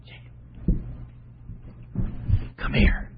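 unvoiced urgent whisper, leaning in; whispering mother. Scheming mother whispers to her son Jacob, a secret whisper. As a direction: hush it like a scheming mom. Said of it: "So she comes to the son, Jacob, and says".

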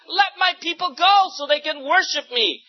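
commanding voice. A narrator's reading. God's warning to Pharaoh.

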